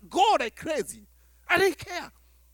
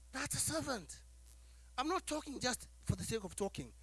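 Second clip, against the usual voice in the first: normal talking voice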